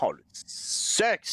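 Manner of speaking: slow, stretched exaggerated moan; milk the word like a cheesy tease; drawn-out mock moan. Mocking game prompt, drawn out.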